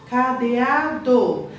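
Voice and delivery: "Cadeado" read slowly, each syllable drawn out. Shown as a demonstration. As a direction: spell out "Cadeado" slowly for a child. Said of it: "o que que nós escrevemos?".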